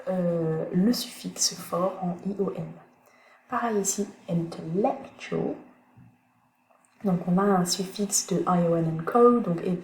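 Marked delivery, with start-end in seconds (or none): 4.3-5.6 "intellectual" said slowly, stress on "-lec-"; slow stress demo. Shown as a demonstration. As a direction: model "intellectual", leaning on "-lec-". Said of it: "juste avant le U A L". ("intellectual" lentement, accent sur "-lec-")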